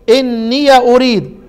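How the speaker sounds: إني أريد recited slowly as إنيَ أريد with the ya opened; Quranic recitation. Shown as a demonstration. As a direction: draw out إني with an open ya. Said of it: "زي ما أنا قرأت كده". (تلاوة إني أريد بفتح الياء)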